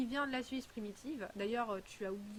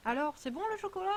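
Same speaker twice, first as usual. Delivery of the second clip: higher, louder, quick sing-song question; mother to toddler; 7 semitones higher, 5 dB louder, faster than usual. Retold or imitated. Mum asks her small daughter about the chocolate, sing-song. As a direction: coax the little one like a playful mum.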